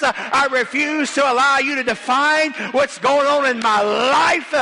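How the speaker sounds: full-force preaching. Acted. Preacher rebuking the devil before the congregation, full-force preaching. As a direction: preach it with rising intensity, stretching the last phrases.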